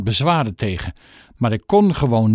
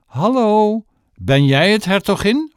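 put-on old tramp voice; 8 semitones higher, 3 dB louder than usual. Tramp calls from shed.

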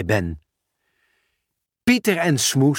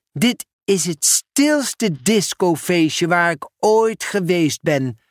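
put-on higher, slow drawn-out kid; sulky child; 5 semitones higher, slower than usual. Mera grumbles about the silent party, higher and slow.

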